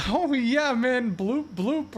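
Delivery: high, easy-going Southern twang; Southern accent. Retold or imitated. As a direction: play it cool in a Southern drawl. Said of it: "in reality, I'm like".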